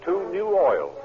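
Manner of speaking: ordinary delivery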